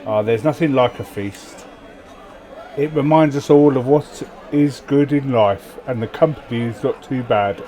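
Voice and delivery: loud, grand storyteller reading; event narration. Acted. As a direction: read the event like a saga. Reads game event text aloud.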